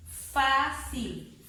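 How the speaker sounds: "fácil" with FÁ long and strong; drawn-out "fácil", force on FÁ; stressed syllable demo. Shown as a demonstration. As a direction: hit FÁ, then drop off in "fácil". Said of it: "dónde está la fuerza de voz".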